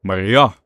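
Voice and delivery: "Maria" with the weight thrown onto the final A; "Maria" stressed at the very end; stress on final vowel. Shown as a demonstration. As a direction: hit the last vowel of "Maria", Portuguese style. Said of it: "on the final vowel".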